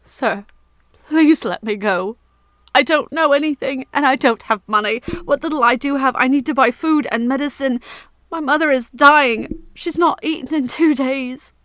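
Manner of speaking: put-on boy weeping while begging the knight; crying plea. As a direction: plead through tears. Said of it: "they rolled down his face".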